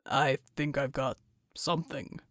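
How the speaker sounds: low, soft rumbling mutter; deep mutter. A narrator's reading. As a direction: mutter it under strain. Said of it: "he muttered".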